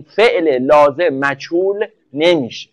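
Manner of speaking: slow, measured emphasis on each word; deliberate rule-stating. Real, not acted. Teacher fixing a grammar rule for students, slow and deliberate. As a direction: spell the rule out slowly.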